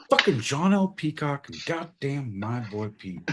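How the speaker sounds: ordinary talk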